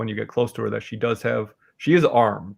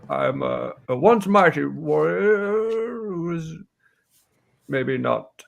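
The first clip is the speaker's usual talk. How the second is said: once-mighty warrior, slow and halting; halting old warrior voice; old warrior woman; 5 semitones higher, slower than usual. Acted. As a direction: play an aging, arthritic warrior offering work. Game master voices Hilin.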